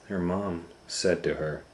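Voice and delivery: ordinary delivery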